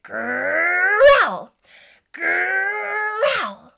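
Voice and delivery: loud, high play roar; playful tiger roar in the song; loud growl. Shown as a demonstration. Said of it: "growl about it".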